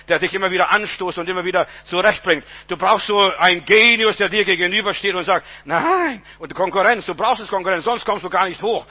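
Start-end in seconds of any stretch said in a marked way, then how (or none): 5.6-6.2 high, swooping no; mock objection, leaping upward in pitch; acted defiant refusal. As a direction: play an opponent refusing, pitched way up. Acts the opponent standing against you.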